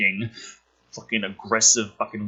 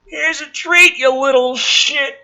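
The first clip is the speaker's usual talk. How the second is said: much higher, louder sneer; mean stoner voice; 13 semitones higher, 6 dB louder, slower than usual. Acted. Stoner taunts Rory before throwing an apple.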